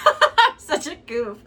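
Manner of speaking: loud, high, laughing self-tease; laughing at herself. Real, not acted. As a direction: draw it out through a burst of laughter. Laughs at own dying phone.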